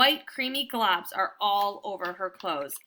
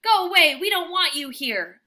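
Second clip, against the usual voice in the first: high, loud guard-dog bark; barking at truck; 6 semitones higher, 7 dB louder than usual. A narrator's reading. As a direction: drive the truck off with your voice. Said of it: "I bark at the truck".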